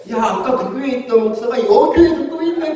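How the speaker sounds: high, loud drunk imitation; amazed drunkard. Drunk thinks the pastor works two churches, loudly amazed. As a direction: play a drunk's comic discovery. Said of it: "두탕 띠고 있다고 막 그러는 거예요".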